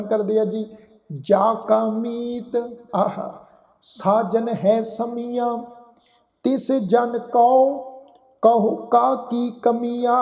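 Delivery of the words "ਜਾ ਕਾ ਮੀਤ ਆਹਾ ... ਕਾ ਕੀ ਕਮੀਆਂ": slow, measured verse reading with held vowels; scripture recitation. Shown as a demonstration. Teacher reciting a Gurbani line to the congregation, slow recitation. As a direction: recite it slowly, phrase by phrase. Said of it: "ਪਾਠ ਅਸੀਂ ਬਹੁਤ ਵਾਰੀ ਉਚਾਰਨ".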